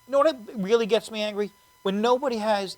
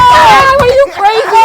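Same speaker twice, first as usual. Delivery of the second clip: much higher, much louder mock outrage; incredulous shout; 13 semitones higher, 16 dB louder than usual. Real, not acted. Host shouting at a friend who lacks E-ZPass. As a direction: yell in disbelief at a friend.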